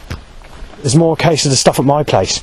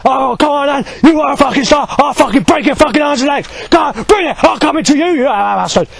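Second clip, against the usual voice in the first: imitating a shouting man; 11 semitones higher than usual. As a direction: ham up the aggressive stranger yelling. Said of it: "he fucking shouts after".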